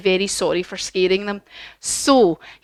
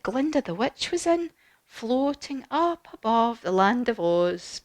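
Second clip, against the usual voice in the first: guided relaxation; 6 dB quieter than usual. Acted. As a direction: ease the children into calm. Leads closing relaxation.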